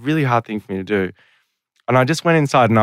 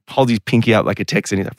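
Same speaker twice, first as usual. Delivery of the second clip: ordinary delivery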